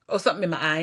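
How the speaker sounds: usual speaking voice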